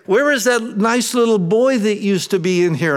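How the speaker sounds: high, put-on nun's voice; scolding school nun. Nun questioning a schoolboy about his behavior, high-voiced. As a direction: mimic a nun's pointed question. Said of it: "the nuns at school would say".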